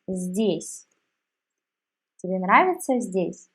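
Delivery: normal talking voice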